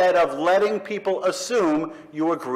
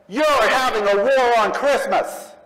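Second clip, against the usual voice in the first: mocking Christians; 5 semitones higher than usual. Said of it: "they say".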